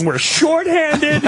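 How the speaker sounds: quoting the frazzled cop's excuse, laughing; cop losing it. Retold or imitated. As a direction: stretch it out high like a cracking cop. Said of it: "He's just losing it".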